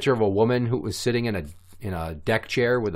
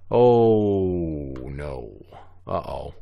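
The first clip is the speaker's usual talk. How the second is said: long, low drawn-out "oh no"; mock dismay; 6 semitones lower, much slower than usual. Astronaut finds a surprise under the skirt, mock dismay. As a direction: sink into a deep, comic realisation. Acted dismay at alien's body.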